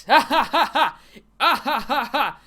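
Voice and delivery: loud, high forced laugh; mock laughter. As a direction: bark out fake ha-ha bursts. Fake laugh at the joke.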